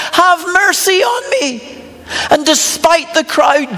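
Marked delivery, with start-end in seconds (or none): 0.1-1.6 high, drawn-out plea, mildly raised; retold yell. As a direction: imitate a beggar calling out slowly. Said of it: "he yells out".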